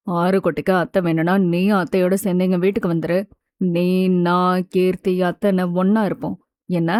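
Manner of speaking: low, gentle male voice; reassuring man. A narrator's reading. Mithul inviting little Aaru to live with them, reassuring. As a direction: offer it gently in a deep, calm tone.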